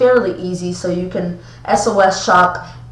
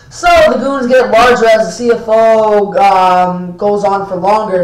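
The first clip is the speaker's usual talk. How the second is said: loud; 6 dB louder than usual. Real, not acted. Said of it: "now I'm just messing with you guys".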